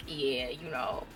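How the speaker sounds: lazy low drawn-out reply; low, slow Che voice. Retold or imitated.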